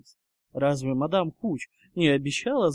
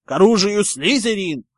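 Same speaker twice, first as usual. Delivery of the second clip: loud, high rallying shout; battle cry; 4 semitones higher, 10 dB louder than usual. Acted. Five Slytherins calling their house to arms, shouting.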